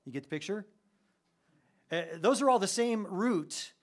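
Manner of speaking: ordinary delivery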